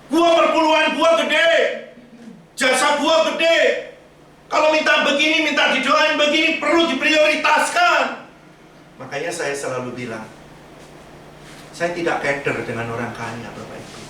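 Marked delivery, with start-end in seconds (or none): 0.1-8.3 much higher, louder boastful churchgoer voice; mock proud donor. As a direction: sneer it out like a smug big giver. Mocking a proud rich churchgoer.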